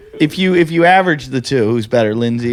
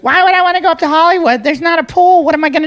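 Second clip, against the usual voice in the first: put-on whiny young woman; 13 semitones higher than usual. Co-host mimicking the girl who wants a pool, whiny and high. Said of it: "She goes".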